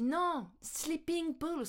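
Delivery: high, very slow, drawn-out correction; imitated correction. Colleague correcting the learner, slow imitated correction. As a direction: spell it out slowly for a confused learner. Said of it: "elle me dit".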